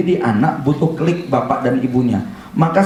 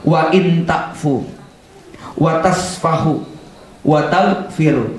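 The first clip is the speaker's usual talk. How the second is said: Quranic recitation; slower than usual. Acted. Quoting a Quran verse.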